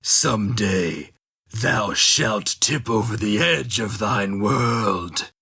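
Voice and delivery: ancient creature voice. Acted. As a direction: intone it slowly like an ancient oracle. Ancient creature prophesies in story.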